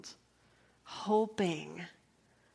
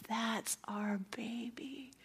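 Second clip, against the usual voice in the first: slow, soft unvoiced whisper of wonder; awed whisper; 2 semitones higher, 6 dB quieter, slower than usual. Real, not acted. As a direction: breathe it out in awe. First sight of newborn.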